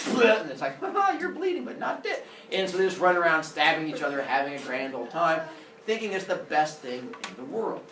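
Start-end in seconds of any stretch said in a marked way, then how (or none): 0.8-2.2 high, teasing singsong with a laugh; gleeful brother. Acted. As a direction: tease your brother gleefully, pitched high. Brothers play at stabbing.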